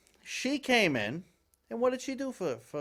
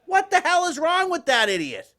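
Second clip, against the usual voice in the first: exasperated outburst; 13 semitones higher, 9 dB louder than usual. Real, not acted.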